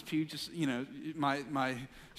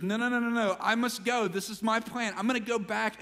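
raised, higher-pitched insistence; acted determination, pitched up; insistent refusal; 6 semitones higher, 3 dB louder than usual. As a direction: overrule their objection firmly. Jesus overruling disciples, retold as dialogue.

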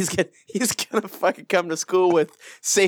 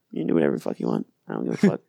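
put-on defiant kid, low growl; much faster than usual. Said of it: "I'm like".

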